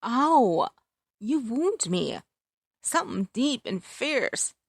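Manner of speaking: theatrical fake pain, clutching the heart; mock hurt. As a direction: playfully pretend he's stabbed you. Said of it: "I pretended to be hurt".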